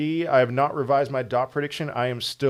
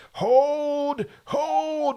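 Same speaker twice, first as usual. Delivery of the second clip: much higher drawn-out battle cry hold; Braveheart-style rallying cry, much higher and held; William Wallace; 14 semitones higher than usual. Pretend. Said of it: "you know, William Wallace and Braveheart".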